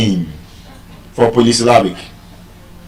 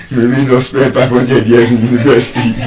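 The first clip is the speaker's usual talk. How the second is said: laughing; 3 semitones lower than usual. Real, not acted. Joke about five hundred years.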